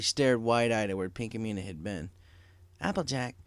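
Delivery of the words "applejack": slightly higher worried friend; growing concern. Acted. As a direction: ask gently then more urgently. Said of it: "her concern growing".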